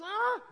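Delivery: high mock yell of frustration; short, high-pitched cry of exasperation; exasperated "ah". Preacher replaying his fed-up feeling at the fire department, exasperated yell.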